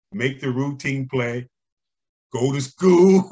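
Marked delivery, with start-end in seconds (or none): 2.3-3.2 louder, higher, punching school; emphatic motto. Retold or imitated. Coach drilling his motto to the kids, emphatic. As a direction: hammer home the advice.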